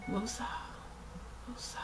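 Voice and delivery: soft calming mantra; self-calming. Pretend. Vlogger calming herself after the lid rant, soft mantra. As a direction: exhale it quietly to settle down.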